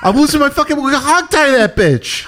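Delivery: high, exasperated falsetto rant; mock frantic rant. Parodying bystanders at the standoff, high frantic rant. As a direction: lose it like a frazzled onlooker.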